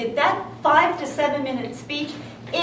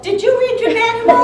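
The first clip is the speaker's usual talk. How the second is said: higher-pitched pointed question; mock interrogation; 9 semitones higher, 5 dB louder than usual. Evaluator checking up on a new speaker, mock interrogation. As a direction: question them as a critical evaluator would.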